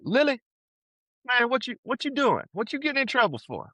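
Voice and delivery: mock the big Southern coach calling out to the kid.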